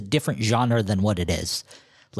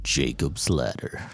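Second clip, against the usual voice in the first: low, slow, soft title drop; dramatic title drop; 11 semitones lower, 5 dB quieter, slower than usual. Acted.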